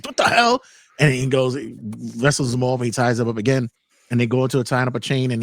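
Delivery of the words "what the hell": sudden high, loud "what the hell"; shocked outburst. Retold or imitated. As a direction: blurt it out in disbelief, pitched way up.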